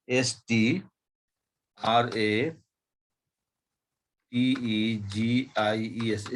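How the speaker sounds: strategies spelled letter by letter as S T R A T E जी I E S; spelling out letters. Shown as a demonstration. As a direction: spell each letter clearly. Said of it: "spelling word for students". (अक्षर अक्षर बोलकर spelling)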